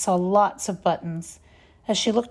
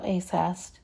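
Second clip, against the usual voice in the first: ordinary delivery, nothing marked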